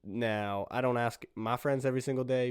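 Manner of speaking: ordinary talk, nothing marked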